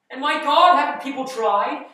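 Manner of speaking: loud, high-pitched cry of exasperation; exasperated outburst. Acted. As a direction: rail at all the failed attempts. Exasperated rant to staff.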